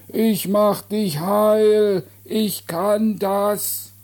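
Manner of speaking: high, very slow drawn-out holler; calling from afar. Acted. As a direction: shout a promise across the distance. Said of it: "kam es erneut". (langgezogenes Rufen aus der Ferne)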